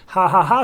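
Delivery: mocking villain laugh.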